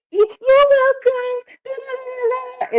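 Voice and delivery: put-on high, slow little kid voice; sweet kids. Siblings making up after the tooth, high and slow. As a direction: coo it like little kids hugging. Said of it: "they all doing".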